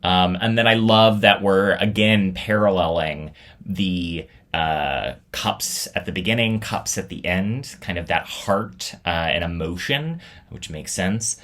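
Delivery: usual speaking voice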